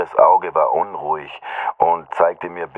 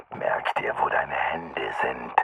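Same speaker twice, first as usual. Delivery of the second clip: slow, low threatening whisper; menacing whisper; 6 semitones lower, 9 dB quieter, slower than usual. A narrator's reading. Unseen attacker threatens the victim in the dark, menacing whisper. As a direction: whisper the threat slowly, close up. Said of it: "sprach die Stimme".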